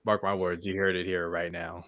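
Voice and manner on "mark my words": normal talking voice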